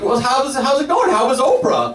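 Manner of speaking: lightly voiced curious father; dad. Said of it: "my dad's like".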